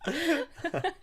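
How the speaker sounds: words broken by laughter; laughing. Real, not acted. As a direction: laugh through the line. Laughing at own joke.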